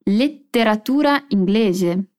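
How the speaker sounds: "Letteratura" slow with a long held TT in "lette"; double T. Shown as a demonstration. Teacher drilling the double T for students, slow. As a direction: hold the double T of "Letteratura inglese" slowly. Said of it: "Dois T's em lette".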